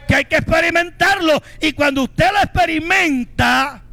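fired-up preacher voice; preaching. Acted. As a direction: drive the message home to the congregation. Preaching at full force.

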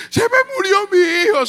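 high, loud wail of a distraught mother; retold screaming. Mother whose child has died, acted screaming. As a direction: act out a mother's anguished cry. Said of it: "sale la hermana gritando".

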